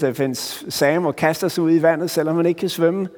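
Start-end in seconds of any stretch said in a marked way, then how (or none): none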